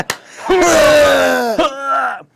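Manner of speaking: loud, high drawn-out puking noise; hammed-up vomiting roar; mock retching. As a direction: heave noisily into a can. Imitates himself vomiting into a can.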